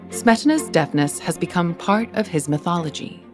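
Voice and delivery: normal talking voice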